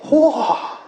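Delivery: high astonished wow; amazed wow. Acted. Jesus amazed at the Canaanite woman, high wow. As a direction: gasp in wonder at her faith.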